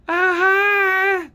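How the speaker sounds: shrill-high, loud mocking greeting; mock a squeaky high office lady's hello; exaggerated high-pitched caricature; Dee's high-pitched voice. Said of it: "That's her voice".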